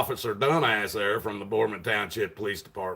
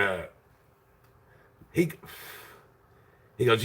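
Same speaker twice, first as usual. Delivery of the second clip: usual speaking voice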